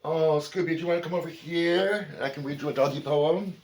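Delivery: high, singsong pet-talk voice; high-pitched invitation to a pet; coaxing the dog. Real, not acted.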